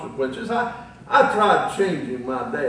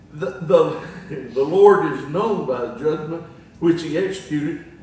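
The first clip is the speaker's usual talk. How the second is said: ordinary talk, nothing marked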